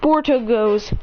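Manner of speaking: usual speaking voice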